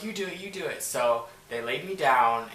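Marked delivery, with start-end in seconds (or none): 0.0-0.8 higher-pitched mock goading; pestering friends. Friends urge her to lie down, pestering.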